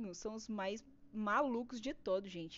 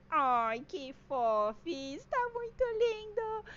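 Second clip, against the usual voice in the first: cutesy cooing; 4 semitones higher, much slower than usual. Real, not acted. Cooing over her own drawing.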